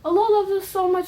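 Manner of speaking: ordinary talk, nothing marked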